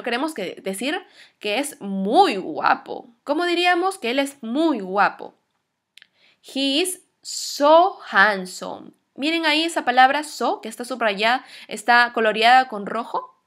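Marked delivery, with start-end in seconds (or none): 6.4-8.9 very slow, with SO stretched and punched; stress on SO. Shown as a demonstration. Teacher shows how to add intensity, drawn-out stress on SO. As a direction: lean long and hard on SO. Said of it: "cuando queremos dar intensidad".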